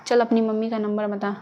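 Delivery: plain reading voice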